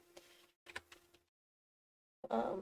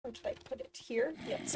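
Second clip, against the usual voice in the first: ordinary delivery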